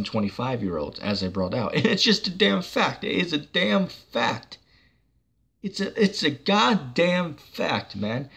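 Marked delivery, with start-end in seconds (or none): 1.8-8.3 high, slow, heated insistence; real irritation breaking through; getting pissed off. Real, not acted. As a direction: hammer the fact home, fed up. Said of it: "I'm getting pissed off at this".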